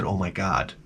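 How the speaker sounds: hushed fake amazement; quiet low sarcastic delight; sarcastic awe. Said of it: "I said".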